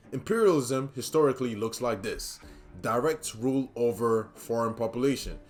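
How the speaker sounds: usual speaking voice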